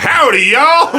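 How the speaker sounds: high, loud mock Southern drawl; Southern accent. Host mocks Alabama Southerners, loud exaggerated Southern accent. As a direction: overdo the Southern twang. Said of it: "that accent is like".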